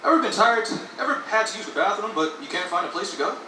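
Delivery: loud, upbeat commercial voice; hard sell ad. Pretend. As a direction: pitch it like a TV ad. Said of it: "say it like an advertisement".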